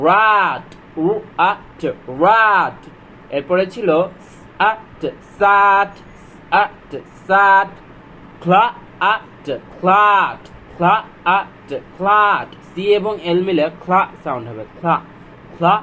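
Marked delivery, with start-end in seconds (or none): 4.7-7.7 slow, stretched sound-out; slow phonics blend. Shown as a demonstration. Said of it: "slow phonics blending demo". 8.4-12.5 slow, stretched sound-out; slow phonics blend. Shown as a demonstration. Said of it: "slow phonics blending demo".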